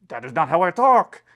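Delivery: loud mock French accent. Friend protesting the teasing about his speech, in a mock French accent. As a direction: imitate a Frenchman's English, loudly. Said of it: "He spoke with this very French accent".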